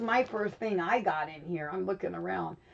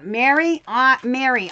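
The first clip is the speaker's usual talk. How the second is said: calling out; 4 semitones higher, 6 dB louder than usual. Real, not acted. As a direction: call out higher and louder for attention. Calling to Mary in chat.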